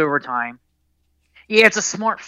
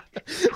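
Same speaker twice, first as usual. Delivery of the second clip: burst of laughter. Real, not acted. Laugh after teasing.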